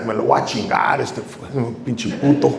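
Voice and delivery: a lightly voiced angry little boxer mumbling threats; angry kid trash talk. Young boxer trash-talking his opponent, muttered tough-kid threat. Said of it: "and then in Spanish".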